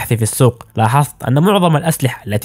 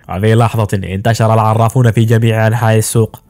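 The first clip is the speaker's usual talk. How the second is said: usual speaking voice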